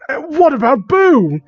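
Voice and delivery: high strained cry of pain; pained high yell for his pet. Acted. Josh yelling at Dark about Boo, high and pained. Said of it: "he yelled".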